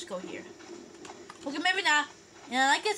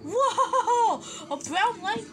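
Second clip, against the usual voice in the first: delighted whoa; 5 semitones higher, 5 dB louder, slower than usual. Real, not acted. Surprised by new game item.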